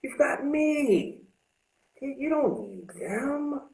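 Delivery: high, slow coaxing inner voice; the addict's inner voice luring him back; voice of addiction. Retold or imitated. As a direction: tempt yourself sweetly, drawn out. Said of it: "a part of you that says".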